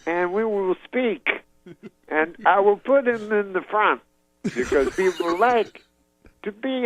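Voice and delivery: high, slow halting Cosby promise; Cosby promising to meet dad, impression. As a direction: promise grandly, slowly, up high. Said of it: "then he says".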